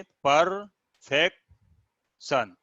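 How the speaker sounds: Perfection very slowly, long gaps between पर, फेक, शन; split into syllables. Shown as a demonstration. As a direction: isolate all three syllables of perfection. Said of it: "ध्यान से देखेंगे". (Perfection को रुक-रुककर पर, फेक, शन बोलना)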